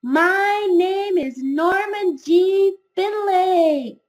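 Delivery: high, loud, slow proud announcement. Acted. Norman introducing himself to the school, loud and proud. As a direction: say your name with new confidence. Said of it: "His voice was now louder and stronger".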